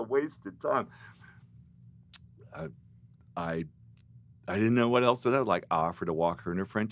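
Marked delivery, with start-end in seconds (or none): none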